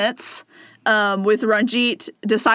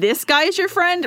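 pitched up disbelieving question; incredulous; 8 semitones higher than usual. Retold or imitated. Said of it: "incredulous quote".

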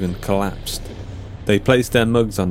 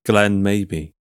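usual speaking voice

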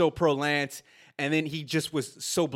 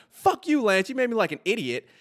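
high-pitched indignant cursing; duped and angry; 7 semitones higher than usual. Retold or imitated. Director lashing out at Lance after being duped, high and indignant. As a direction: lash out at the man who fooled you. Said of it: "the director getting duped".

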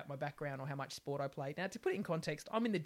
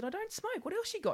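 sharply higher, flippant comeback; cheeky retort. Retold or imitated. Her retelling to the doctor, a high flippant comeback. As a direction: toss it back at him, unbothered. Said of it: "I said".

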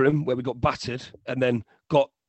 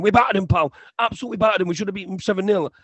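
high, loud mimicked phone rant; imitated ranting fan; 6 semitones higher, 5 dB louder, faster than usual. Fan on phone to his mate, high insistent imitated rant. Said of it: "on his own on phone to his mate going".

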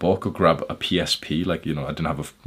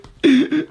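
put-on baby wail; 9 dB louder than usual. Shown as a demonstration. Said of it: "like, a very stereotypical, like, baby way".

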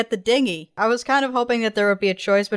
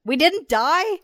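high amazed question; can't believe we survived; surprised; 7 semitones higher, much slower than usual. Real, not acted. Surprised at ending.

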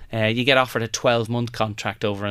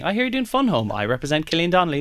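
a lightly voiced agent pitching his client, pitched higher; mock agent pitching hard on the phone; 6 semitones higher, faster than usual. Agent calling casting to pitch Killian, eager sales pitch. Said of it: "He just put a call into the casting".

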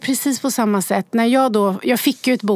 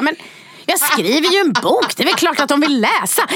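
higher-pitched, indignant retort; shocked disbelief; 6 semitones higher than usual. Retold or imitated. Author answering her doubting friend, shocked disbelief. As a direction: retell it as if the doubt were absurd. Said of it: "jag tittar på henne helt chockad".

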